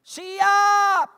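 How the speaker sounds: high, drawn-out shout; shouted compliance. Retold or imitated. Husband instantly obeying wife's wish, shouted compliance. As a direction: bellow it like an obedient recruit.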